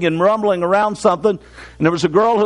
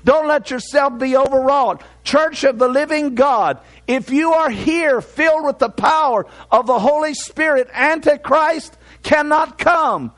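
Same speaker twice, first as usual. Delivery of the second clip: higher-pitched, drawn-out sermon delivery; preaching; 5 semitones higher, slower than usual. Real, not acted. Preacher urging the church not to be distraught, preaching. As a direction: preach it in firm, measured phrases.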